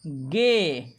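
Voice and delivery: "ge" stretched, rising then falling; demonstrating "ge". Shown as a demonstration. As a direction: sound out "ge" for learners. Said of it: "तो ये हुआ".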